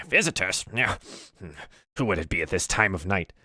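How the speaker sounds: gruff, grumbling goblin just woken; gruff voice, roused from sleep; gruff old goblin. Acted. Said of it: "a, a gruff".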